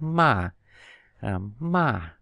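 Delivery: sharp falling "ma"; Mandarin fourth tone. Shown as a demonstration. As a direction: drop "ma" from the top. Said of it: "đọc từ cao xuống thấp".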